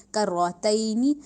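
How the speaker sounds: کرتینی drawn out slowly with a rounded ر; slow tajweed demo; slow heavy recitation. Shown as a demonstration. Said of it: "منہ گول ہو رہا ہے".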